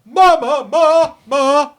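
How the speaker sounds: high, loud mock cry of ma; high, loud cartoon calling voice; imitating Terracotta's call. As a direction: ham up a big nasal-free yell of ma ma ma.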